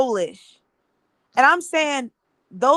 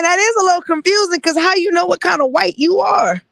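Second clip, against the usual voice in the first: higher and louder, near shouting in disbelief; worked-up disbelief; 7 semitones higher, 5 dB louder than usual. Real, not acted. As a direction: raise your voice, honestly baffled by the form. Baffled rant about race categories.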